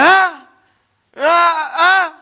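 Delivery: high, loud crying "ah, ah, ah"; panicked stammer. Acted. Dead man questioned in the grave, high crying stammer. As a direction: stammer in helpless terror. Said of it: "He said".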